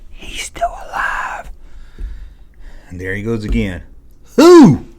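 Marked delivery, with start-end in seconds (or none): 0.1-1.5 slow breathy whisper. Retold or imitated. Whispering again to his friend in the theater. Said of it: "And I whispered that". 4.4-4.9 loud question. Retold or imitated. Friend loudly asking in theater.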